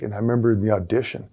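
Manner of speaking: ordinary talk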